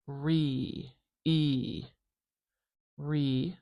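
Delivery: "Re" drawn out as a long pure ee vowel. Shown as a demonstration. Teacher isolating the first vowel of the noun, drawn out long. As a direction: stretch the "e" in "Re" into a true long vowel. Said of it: "changing the schwa to a pure vowel".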